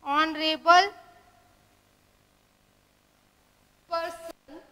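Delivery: slow example reading, broken into pieces; dictation. Shown as a demonstration. Teacher dictating honorable example to students, slow dictation. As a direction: pause long between words as if writing them out.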